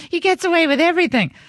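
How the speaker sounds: mock upset daughter. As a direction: imitate a sulking kid complaining. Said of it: "Maria gets mad".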